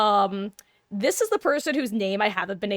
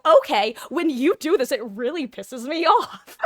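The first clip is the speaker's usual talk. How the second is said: louder, higher blunt admission breaking into a laugh; blunt outburst; 4 semitones higher, 6 dB louder than usual. Retold or imitated. Telling off her roommate directly, loud and laughing. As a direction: blurt it out loud then crack up laughing. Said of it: "And I go".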